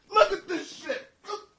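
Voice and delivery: normal talking voice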